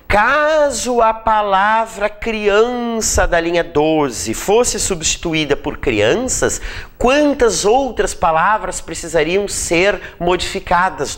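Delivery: slow, deliberate question reading; measured dictation, words stretched out; reading out exam question. Acted. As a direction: spell it out slowly like a test item. Reads out a sample exam question.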